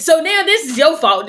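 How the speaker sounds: loud accusation, with a laugh; loud, accusing, laughing bitterly. Retold or imitated. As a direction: blame him to his face. Confronting the surgeon.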